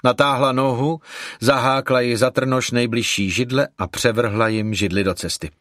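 usual speaking voice

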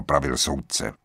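usual speaking voice

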